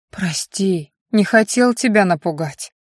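ordinary delivery

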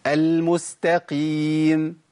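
final mim of المستقيم held neither long nor cut; slow correct tajweed demo; moderate mim. Shown as a demonstration. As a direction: recite المستقيم with a medium closure. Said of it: "متوسطة".

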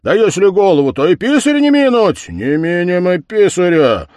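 loud, high gleeful scheming; eager plotting. Acted. The lads plot a prank on the head, loud and gleeful. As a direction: get everyone fired up.

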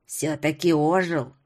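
put-on low, slow creaking old woman; spiteful creaky crone. A narrator's reading. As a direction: creak it out with malice. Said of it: "злобно проскрипела".

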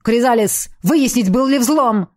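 loud barked order; roaring. A narrator's reading. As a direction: roar the command at the ship. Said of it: "взревел тарианец".

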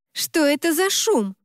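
high, slow indignant question; seething. A narrator's reading. Best friend asking about a noise, seething with anger. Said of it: "явно закипая от ярости".

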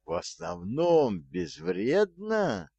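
high, slow outraged disbelief; incredulous cry. A narrator's reading. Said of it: "Вскричал Артур".

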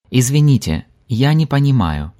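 normal talking voice

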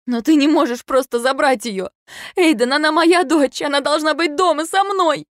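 high, loud panicked plea; mother pleading to keep her daughter; desperate protest. A narrator's reading. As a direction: fight for your child.

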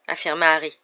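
usual speaking voice

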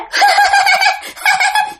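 very high, piercing cackle; possessed laugh. Retold or imitated. Possessed woman laughs at the mother, high mad cackle. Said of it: "Mà cứ hỏi cười vậy".

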